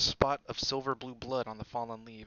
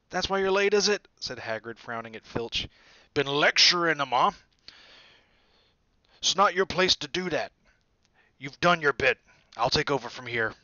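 loud, higher, indignant rebuke; Hagrid reproaching; 4 semitones higher, 10 dB louder than usual. A narrator's reading. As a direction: tell him off to his face. Hagrid scolding Filch, loud.

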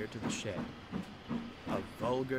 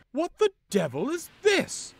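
much higher, loud indignant cry; startled outburst; 13 dB louder than usual. Acted. Loaned engine startled by Henry, shouting. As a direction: exclaim in shock.

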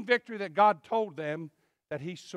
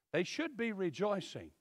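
quiet repeat; 2 semitones lower, 9 dB quieter than usual. Shown as a demonstration. Preacher to church, repeating the point softly. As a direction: restate "should" gently and quietly.

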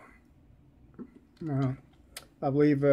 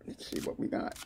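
whispered under his breath; a quick unvoiced whisper; 10 dB quieter than usual. Real, not acted. Muttering to himself while unboxing.

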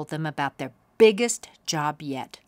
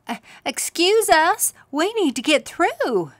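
put-on much higher, polite small-girl voice; little girl voice; 11 semitones higher, 6 dB louder than usual. Girl trying to get past the clowns, higher and polite. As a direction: squeak it out politely like a young girl. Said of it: "said Katie".

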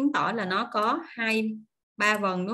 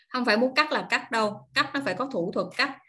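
normal talking voice

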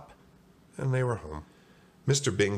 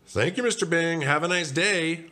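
high, called-out shopkeeper thanks; high, slowing shop farewell; baker to customer; 10 semitones higher, 4 dB louder than usual. Acted. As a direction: send off a customer in a high, cheery call. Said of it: "Arnie heard the baker say".

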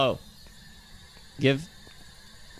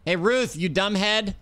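taunting call; 8 semitones higher, slower than usual. Real, not acted. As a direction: needle the ghost into answering. Taunting the ghost.

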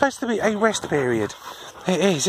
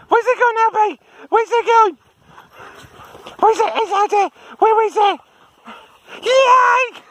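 much higher, louder cheering at the dog; excited high pet voice; 10 semitones higher, 3 dB louder than usual. Real, not acted. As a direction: hype the dog up as he chases. Cheering dog chasing ball.

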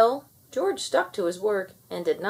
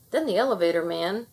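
usual speaking voice

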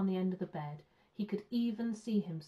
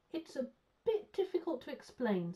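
higher-pitched, rueful little explanation; mildly sad; 7 semitones higher than usual. A narrator's reading. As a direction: explain it with a touch of sadness. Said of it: "said Paddington sadly".

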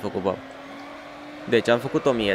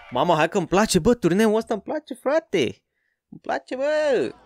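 high, excited praise for the game; thrilled delight; 10 semitones higher than usual. Real, not acted. Streamer loving the race tournament, thrilled high voice. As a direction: gush over the tournament, stretching the last words.

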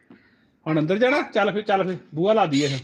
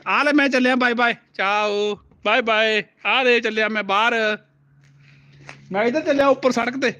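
teasing goodbye; 7 semitones higher than usual. Pretend. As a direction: pretend you are leaving, tease the child. Pretends to leave, teasing toddler. (ਛੇੜਦੇ ਹੋਏ ਲਮਕਾ ਕੇ ਅਲਵਿਦਾ)

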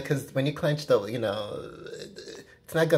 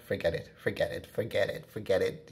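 softer, lower fed-up dismissal; fed-up giving up; 6 semitones lower, 5 dB quieter than usual. Retold or imitated. To a partner who can't relax, fed-up soft muttering. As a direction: drop the voice and wave it off, muttering. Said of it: "Or you just get so frustrated".

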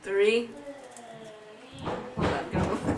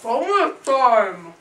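mouth full of crackers; 4 semitones higher, 5 dB louder than usual. Real, not acted. Shouting mid saltine challenge, mouth stuffed.

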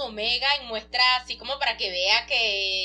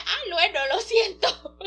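put-on higher, giggly child apology; sheepish little girl; 6 semitones higher, 3 dB louder, slower than usual. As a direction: say sorry like a cheeky little girl. Daughter apologizing to her father.

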